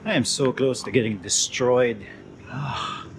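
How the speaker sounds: shaky from exhausted hands; worn out, hands trembling; adrenaline shakes. Real, not acted. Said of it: "My hands are shaking".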